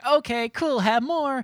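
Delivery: imitating a pushover mom in a high voice; high, mock-agreeable mom voice; giving in cheerfully. Pushover mother giving in, retold.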